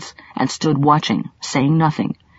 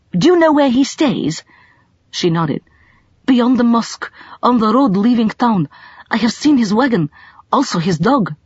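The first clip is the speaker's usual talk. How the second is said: put-on lightly raised girl's voice, mildly wary; young local girl; 6 semitones higher than usual. A narrator's reading. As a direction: voice the girl a touch higher and careful.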